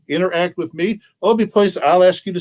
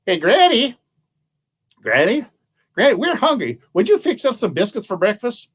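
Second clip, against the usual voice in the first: put-on higher, pleading children calling Granny; a family of children whining for breakfast; hungry kids calling Granny; 7 semitones higher than usual. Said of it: "they turn to the little old woman and say".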